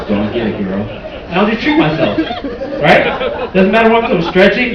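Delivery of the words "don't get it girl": very deep, soft seductive voice; low purring come-on; sex voice.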